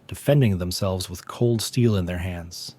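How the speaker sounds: plain reading voice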